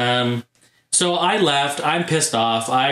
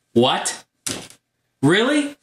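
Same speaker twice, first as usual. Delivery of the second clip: stunned disbelief; 7 semitones higher, much slower than usual. Retold or imitated. Retelling a fan's offer of the games, stunned disbelief. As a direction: blurt it out high in disbelief. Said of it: "I was freaking out".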